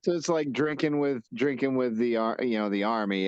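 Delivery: usual speaking voice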